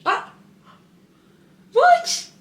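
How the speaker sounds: high excited squeal of her name; delighted squeal. Real, not acted. Mother squealing with delight at her baby.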